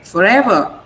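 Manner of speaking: forever peaking on EVER; stress on EVER. Shown as a demonstration. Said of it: "the ever is stressed".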